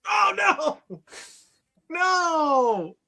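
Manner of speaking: high anguished cry of no. Real, not acted. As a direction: wail no as it dies. Character dies in game.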